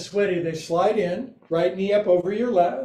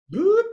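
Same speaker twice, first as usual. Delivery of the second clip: high playful boop; 13 semitones higher, 5 dB louder than usual. Acted. Playful cue coming up.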